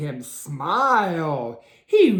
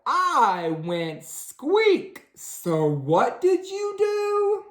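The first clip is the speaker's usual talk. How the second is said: slow mouse voice; 11 semitones higher, much slower than usual. A narrator's reading.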